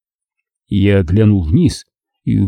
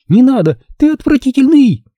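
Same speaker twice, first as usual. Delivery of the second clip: high, louder pleading insult; desperate shriek; 3 dB louder than usual. A narrator's reading. Nina shrieking at Rick before the splash. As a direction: shriek it as you're thrown.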